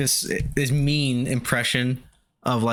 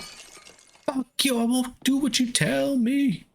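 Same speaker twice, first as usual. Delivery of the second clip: a much higher, slow, put-on voice spitting defiance; defiant brat voice; much slower than usual. Mocking an enemy in the game with a squeaky rebellious voice. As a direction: play a stubborn kid refusing orders.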